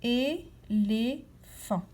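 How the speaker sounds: "éléphant" drawn out, é-lé-phant, slowly; slow syllable-by-syllable. Shown as a demonstration. Teacher to child listening for syllables, slow and drawn out. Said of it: "À quel endroit entends-tu la syllabe lé".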